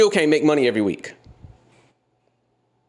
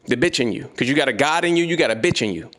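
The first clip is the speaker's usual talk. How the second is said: normal talking voice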